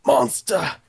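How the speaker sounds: high, slow, dramatic impression; hammed-up warrior's lament; Vegeta. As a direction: drag out each word in a put-on anime voice. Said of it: "That's as close as I'm gonna get".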